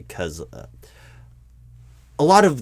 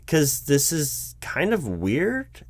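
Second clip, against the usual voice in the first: uneasy and slow; 6 semitones higher, much slower than usual. Acted. Interviewer uneasy with boss.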